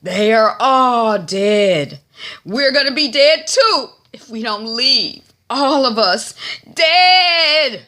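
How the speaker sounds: loud, slow, drawn-out wail of doom; town crier alarm. Retold or imitated. Town crier warning the whole group, loud doomsaying. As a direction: proclaim it high and hammed-up, stretching key words. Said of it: "the town crier says again".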